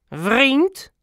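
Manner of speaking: high, rising nasal snap; snarled question. A narrator's reading. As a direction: snap it back in disbelief. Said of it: "snauwde meneer Moeial". (hoog opgetrokken, snauwende vraag)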